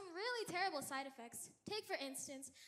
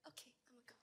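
hushed whisper aside; soft whispered exit line; 6 semitones lower, 24 dB quieter, faster than usual. Real, not acted. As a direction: whisper it, ducking out. Aside, leaving.

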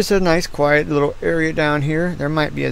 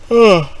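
loud groaning yawn; 7 semitones higher, 10 dB louder than usual. Real, not acted. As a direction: let out a big tired yawn. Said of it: "I don't know why I'm yawning".

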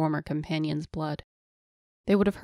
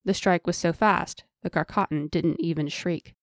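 plain reading voice

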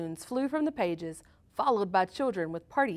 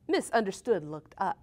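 plain reading voice

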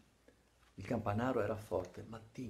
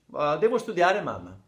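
protesting son; 5 semitones higher, 11 dB louder than usual. Acted. Gianni mente alla madre.

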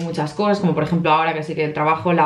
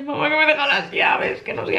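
whiny daughter; 7 semitones higher than usual. Retold or imitated. She answers mum on phone.

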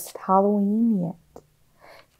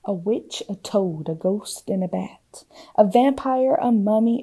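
ordinary delivery, nothing marked

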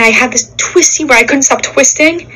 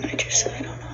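whisper; 18 dB quieter than usual. Real, not acted. Admits not being okay.

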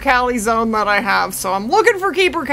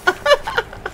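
embarrassed laugh. Real, not acted. Laughs at own panic.

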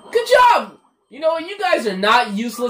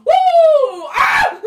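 loud high whoop of glee; excited whoop; 6 dB louder than usual. Real, not acted. Gamer whooping at a big jump. Excited cheering while playing.

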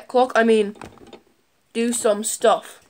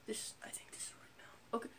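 barely audible mumble; whispered aside; 27 dB quieter than usual. Real, not acted. Vlogger fiddling with the fake tears, whispering. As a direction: murmur to yourself under your breath. Muttering while setting up.

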